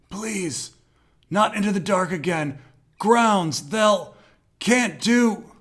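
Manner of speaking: high, pleading cry of terror; warped by fear. A narrator's reading. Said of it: "warped by fear".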